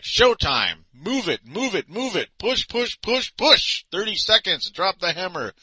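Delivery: high, pushy drill commands; screaming instructions. Retold or imitated. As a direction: bark it like a drill coach. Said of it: "screams instructions like".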